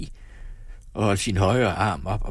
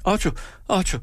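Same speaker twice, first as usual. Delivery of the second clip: lamenting; 11 semitones higher than usual. A narrator's reading. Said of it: "klagede hun".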